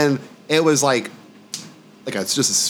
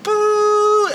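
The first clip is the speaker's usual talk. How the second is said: ghostly boo. Retold or imitated. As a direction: wail "Boo" like a spirit. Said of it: "and it's like".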